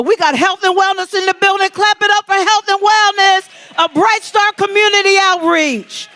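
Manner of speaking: rally speech. Real, not acted. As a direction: pump up the crowd, shouting. Host hypes department.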